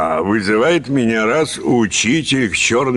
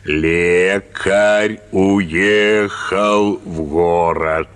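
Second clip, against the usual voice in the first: "Лекарь уехал в город" dragged out very slowly; slow dictation again; 5 semitones lower, much slower than usual. Retold or imitated. Teacher dictating the same phrase once more, very slowly. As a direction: read it out like a schoolmaster dictating. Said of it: "опять пиши".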